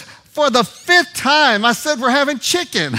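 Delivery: very high, loud, slow fed-up shout; exasperated yell. Retold or imitated. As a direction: bark it back, sick of repeating yourself. Exasperated wife yells back.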